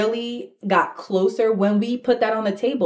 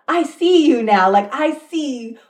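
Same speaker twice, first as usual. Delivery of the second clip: awed realization; 5 semitones higher, 4 dB louder than usual. Retold or imitated. Retold moment of realization.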